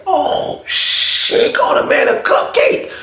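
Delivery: hushed aside in disbelief; shocked whisper. Retold or imitated. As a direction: whisper it so nobody hears. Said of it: "I was like".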